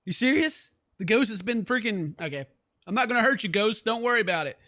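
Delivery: usual speaking voice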